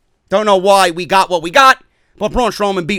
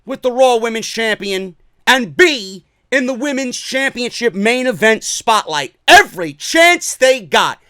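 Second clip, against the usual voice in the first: ordinary delivery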